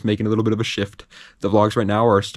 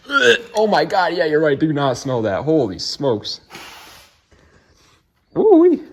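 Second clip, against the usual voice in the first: disgusted; 7 semitones higher, slower than usual. Real, not acted. Reacting to the filthy smelly carb.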